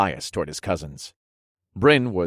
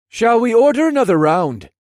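high, loud, jolly offer; grinning interjection; 8 dB louder than usual. A narrator's reading. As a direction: break in through a grin. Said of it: "interjected with a grin".

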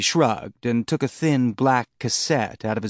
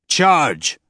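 loud, raised battle cry; shouted command; 5 semitones higher, 5 dB louder than usual. A narrator's reading. Bull orders his people forward, shouting. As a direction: call out the order to attack. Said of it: "Bull shouted".

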